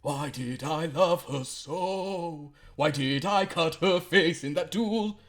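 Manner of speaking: high, loud, slow lament; hammy self-pitying naval lament; the commodore pours out his four loves; lovelorn commodore. Acted.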